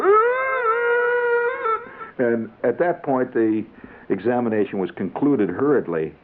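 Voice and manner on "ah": very high strangled gagging cry; the kid brother gags on the stick, strangled high cry; retching gag. Retold or imitated. Sick kid's high gagging cry.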